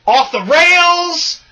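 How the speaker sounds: high, loud, stretched-out catchphrase; catchphrase delivery. Shown as a demonstration. Host offering another catchphrase to co-host, catchphrase delivery. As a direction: belt it out long and high. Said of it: "saying it as a catchphrase".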